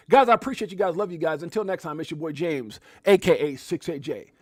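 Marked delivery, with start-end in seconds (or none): none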